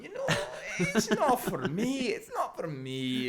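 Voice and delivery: put-on loop the lines lazily in a Glaswegian drunk voice; mock Glaswegian drunk looping his lines; Glaswegian accent. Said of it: "try and do an accent".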